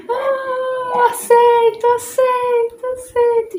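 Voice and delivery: high, loud, crying voice breaking with emotion; choked, high-pitched crying acceptance; tearful yes. Retold or imitated. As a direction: sob out the yes as if overwhelmed. Tearful acceptance after crying scene.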